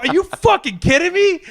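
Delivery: high-pitched, exasperated disbelief; incredulous outrage. Retold or imitated. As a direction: fire it back, voice jumping way up. Acted outrage at her remark.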